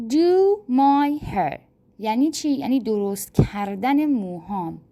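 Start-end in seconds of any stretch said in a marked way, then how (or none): 0.1-1.6 slow and louder, stressing do; slow, loud model phrase. Shown as a demonstration. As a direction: announce the new verb slowly to learners. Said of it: "فعل ششم". (آهسته و بلند)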